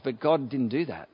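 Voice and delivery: ordinary talk, nothing marked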